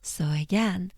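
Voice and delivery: ordinary talk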